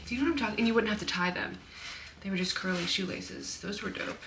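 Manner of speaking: normal talking voice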